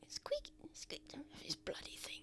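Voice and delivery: whispered squeaks and muttered curses; whispering. Acted. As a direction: hush it, like sneaking by a creaky slider. Whispered squeaky door sounds.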